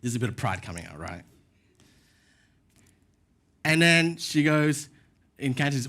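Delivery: ordinary talk, nothing marked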